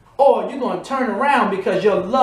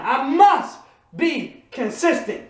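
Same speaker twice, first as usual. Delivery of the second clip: slow, higher, must leaned on; slow declaration; 3 semitones higher, slower than usual. Shown as a demonstration. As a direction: call it out slowly once more. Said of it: "One more time".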